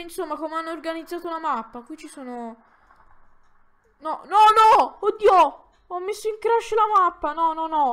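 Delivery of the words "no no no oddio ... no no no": loud, higher panicked outcry; real alarm at breaking something. Real, not acted. Player who just crashed the map, loud panicked cry.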